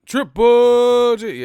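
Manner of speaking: drawn-out, high, booming ring announcer call; slow booming fight-night intro. Pretend. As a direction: boom the name like a ring announcer. Hyped announcer call of name.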